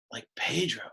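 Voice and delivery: breathy whispered aside, pitch dropped. Acted. Teacher whispers a movie joke to the class, whispered. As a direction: let the joke slip out under your breath.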